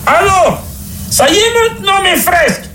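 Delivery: cri suraigu d'impatience; pape qui crie. Acted. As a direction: crier très haut à travers la porte. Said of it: "il s'enfuit à la porte et cria". (pape impatient qui s'égosille dans l'aigu)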